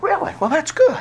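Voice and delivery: lightly voiced teacher's surprised approval; mock surprise. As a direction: professor hears the calls, surprised. Professor reacts to his calls.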